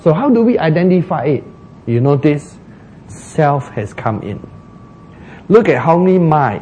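ordinary talk, nothing marked

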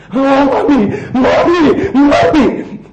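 loud, high hammed-up wailing for mommy; mock baby crying. Preacher acts Jacob bawling for his mother, mock wail. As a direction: wail like a big baby, laughing through it. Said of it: "Cry like baby".

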